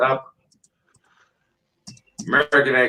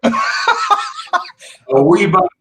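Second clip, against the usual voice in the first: a slow, much higher Scottish voice with a laugh; Scottish accent; 4 dB louder, much slower than usual. Retold or imitated. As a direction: stretch out wee button, laughing. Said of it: "You're talking Scottish".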